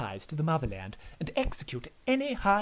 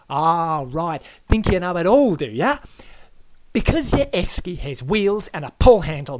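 louder, higher Aussie laying down the rules; loud Aussie lecture; 4 semitones higher, 8 dB louder than usual. Acted. Host Aaron lectures the guest on esky rules, loud and bossy. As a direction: hector him like a know-all.

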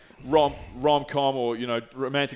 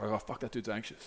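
quiet inner thought; 3 semitones lower, 14 dB quieter than usual. Retold or imitated. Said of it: "I'm like".

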